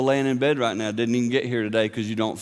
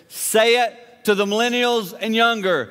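rising preaching shout; 10 semitones higher, 4 dB louder, slower than usual. Real, not acted. Preacher building to a climax.

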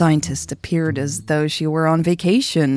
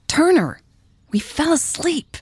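hissed urgency; 12 semitones higher than usual. A narrator's reading. Michelle to Turner, still asleep on the couch, hissing. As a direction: hiss it at someone who won't wake. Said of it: "Michelle hissed".

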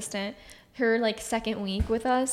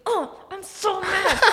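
sleepy mock mad; 8 semitones higher, slower than usual. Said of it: "He's like".

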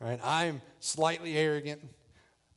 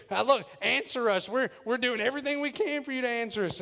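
higher, frantic-sounding plea; acted desperate appeal, pitched up; mock calling out; 7 semitones higher than usual. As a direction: imitate prophets begging for an answer. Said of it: "started really calling on their God".

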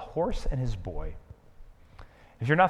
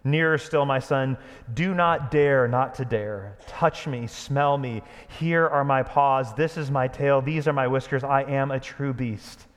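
louder, slow and commanding; 2 semitones higher, 5 dB louder than usual. Acted. Aslan summoning the frightened horse Bree, commanding. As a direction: call him near with grave authority. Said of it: "spoke in a louder voice".

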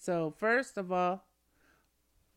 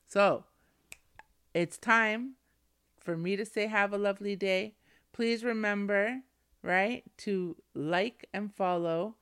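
upbeat podcast outro; 2 semitones higher, 3 dB louder than usual. Acted. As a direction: cheerfully send the audience off. Podcast sign-off to listeners.

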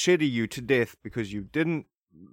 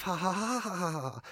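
fake laugh; 5 semitones higher, 5 dB quieter than usual. Shown as a demonstration. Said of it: "just like that".

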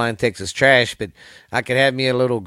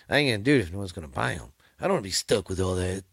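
mock grumbling; 5 semitones lower, 6 dB quieter, much faster than usual. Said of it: "I'm just kidding".

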